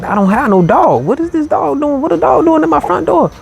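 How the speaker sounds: rising high and puzzled; baffled. Retold or imitated. Man hearing a dog at his door, high baffled questions.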